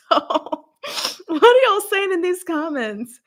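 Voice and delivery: high, loud, laughing through it. Real, not acted. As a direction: ask the comments while cracking up. Laughing question to viewers.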